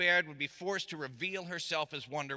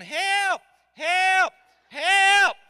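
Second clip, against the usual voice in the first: high, loud cries of help building up; yelling for help; 12 semitones higher, 11 dB louder, much slower than usual. Retold or imitated. Kids on the playground yelling for the teacher, full shouts. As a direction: scream for help like a kid in peril. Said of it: "And we all started yelling".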